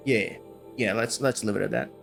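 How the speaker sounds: normal talking voice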